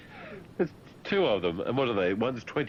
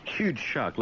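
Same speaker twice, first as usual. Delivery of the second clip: usual speaking voice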